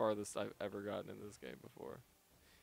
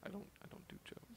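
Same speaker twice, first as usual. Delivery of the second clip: whispered deadpan; 7 semitones higher, 16 dB quieter than usual. Pretend.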